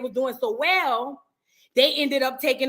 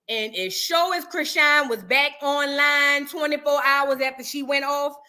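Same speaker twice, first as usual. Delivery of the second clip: ordinary talk, nothing marked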